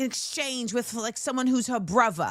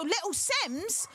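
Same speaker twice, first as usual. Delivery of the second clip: mock British accent, softer and slow; British-accented name; 6 semitones higher, 5 dB quieter, slower than usual. Naming the British rapper, mock British accent. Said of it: "say her name like that".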